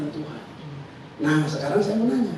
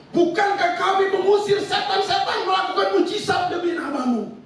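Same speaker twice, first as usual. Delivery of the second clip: pleading shout; 8 semitones higher than usual. Retold or imitated. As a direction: cry out your good deeds as proof. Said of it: "Mereka teriak begini". (orang-orang berseru kepada Tuhan, memohon dengan suara tinggi)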